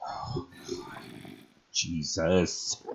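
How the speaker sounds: usual speaking voice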